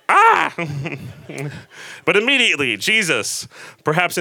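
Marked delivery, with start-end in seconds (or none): 0.1-0.5 high, loud startled scream; cry of fear. Acted. Disciples on the boat screaming in mock fright. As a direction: shriek like you've seen a ghost. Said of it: "they cried out in fear".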